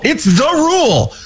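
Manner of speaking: high, stressed rule; insisting on the golden rule, stressed; emphatic. Real, not acted.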